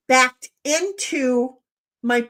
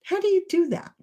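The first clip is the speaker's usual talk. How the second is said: 1 amazed nephew; 7 semitones higher than usual. Retold or imitated. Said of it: "He goes".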